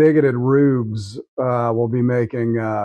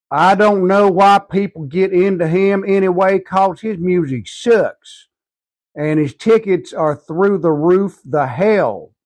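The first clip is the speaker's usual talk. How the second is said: higher, louder, slow grumbling yokel; mocking commenter voice; 6 semitones higher, 4 dB louder than usual. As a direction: ham up an angry commenter. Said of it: "a guy with two first names who says".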